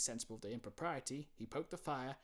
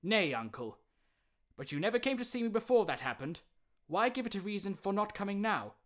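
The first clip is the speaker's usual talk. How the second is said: higher, quick earnest plea; pleading nephew; 9 semitones higher, 9 dB louder than usual. Acted. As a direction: reason with him.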